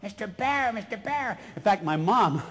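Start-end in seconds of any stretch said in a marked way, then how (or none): 0.0-1.4 higher, half-formed duckling voice; a kid trying out a cartoon duck, not quite there; practised duck voice. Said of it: "I kept trying it out".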